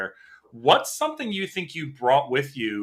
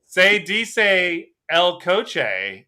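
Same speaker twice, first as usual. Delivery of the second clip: put-on slow, loud American-accented Spanish; hammed-up gringo Spanish accent, pitched up; 5 semitones higher, 5 dB louder, much slower than usual. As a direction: imitate a learner's careful Spanish in an American accent.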